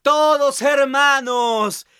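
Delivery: muy agudo y lento; proclámalo estirando cada sílaba; triumphant cry. Acted. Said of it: "gritan el hierro y el trigo". (hierro y trigo proclaman juntos, agudos y lentos)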